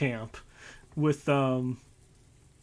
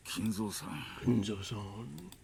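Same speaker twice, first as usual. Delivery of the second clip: put-on gentle old man voice; 3 semitones lower, 6 dB quieter, slower than usual. Old doctor character voiced.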